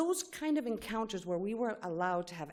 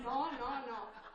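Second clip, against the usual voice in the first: laughing; 7 semitones higher, 10 dB quieter than usual. Acted. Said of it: "So, watch closely".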